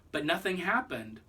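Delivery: ordinary delivery, nothing marked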